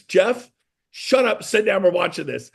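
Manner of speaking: mock bossy order. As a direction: order your buddy to sit.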